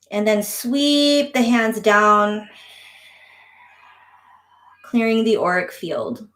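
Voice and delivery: slow, calm instruction voice; guided breathwork. Shown as a demonstration. Guiding the breathing exercise.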